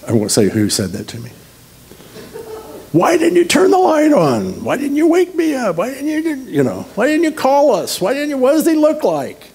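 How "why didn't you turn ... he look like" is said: very high-pitched imitated pestering; mock nagging. Someone berating him for not waking them, mock shrieky nagging. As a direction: fire off questions in a squealing mimic voice.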